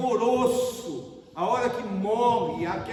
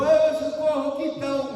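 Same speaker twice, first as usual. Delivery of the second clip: raised, louder nurse's call; retold shout; 7 semitones higher, 6 dB louder than usual. Preacher retelling the nurse calling in emergency room, raised shout. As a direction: call out for help across the ward. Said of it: "O enfermeiro gritou".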